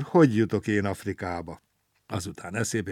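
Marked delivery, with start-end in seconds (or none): none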